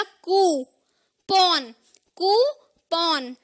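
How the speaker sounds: कूपन slowly split "koo - pawn"; stressed o. Shown as a demonstration. Teacher showing what it becomes, कूपन as "koo - PAWN". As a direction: say कूपन with a full o, a gap between syllables. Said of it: "ओ पे stress".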